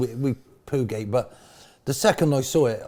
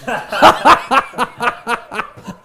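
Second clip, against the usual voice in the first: loud, high-pitched cackling laugh; roaring laughter; 12 semitones higher, 12 dB louder than usual. Real, not acted. Guest laughing at own sandwich joke, roaring. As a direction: laugh hard in a long high volley.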